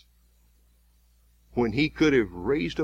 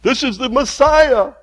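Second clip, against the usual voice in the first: much higher and louder jeering cry; mocking soldiers; 10 semitones higher, 6 dB louder than usual. Soldiers jeering at their prisoner, high and loud. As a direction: howl the mock title up high.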